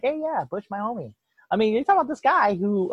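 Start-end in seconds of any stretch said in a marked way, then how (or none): 0.0-1.1 higher, softer chummy impression; a light mock of a cheery host; mock Ellen DeGeneres. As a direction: gush like a friendly talk show host. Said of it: "Ellen DeGeneres is like".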